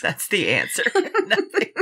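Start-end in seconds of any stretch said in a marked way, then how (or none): 0.9-1.7 laughing high through the word. Real, not acted. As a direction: say it while breaking into laughter. Laughing echo of the answer.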